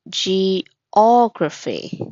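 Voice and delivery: "Geography" drawn out slowly, syllable by syllable, with a clear stressed syllable; careful stress model. Shown as a demonstration. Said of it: "The stress is on geography".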